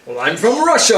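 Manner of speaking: put-on thick Russian accent, loud. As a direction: play a Russian GM, heavy Russian accent. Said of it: "the greatest Russian accent I've ever heard".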